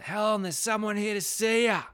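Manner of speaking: high, loud call toward the house; retold yell. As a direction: call out to someone inside the house. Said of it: "he yells out".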